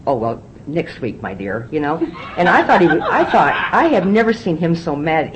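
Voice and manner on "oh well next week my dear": soft and slow, sweetly indulgent; mock gentle leniency. Imagining her sponsor letting her off, soft and slow. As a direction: coo it like a doting grandparent, softer.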